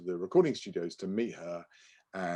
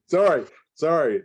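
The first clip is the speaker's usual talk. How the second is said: apologizing through real laughter, higher and louder; laughing apology; 9 semitones higher, 11 dB louder than usual. Real, not acted. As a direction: laugh it off while saying sorry. Laughing apology mid-sentence.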